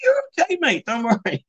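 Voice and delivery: a high, teasing there-there to a panicking mate; mock soothing. Teasing his panicking mate with mock high comfort. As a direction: pretend to calm him while winding him up.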